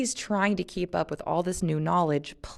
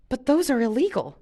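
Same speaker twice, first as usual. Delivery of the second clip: higher, pleading protest; 8 semitones higher, 3 dB louder than usual. A narrator's reading. Luke protesting to Jen that chips are illegal, higher protest. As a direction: object in alarm. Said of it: "Luke protested".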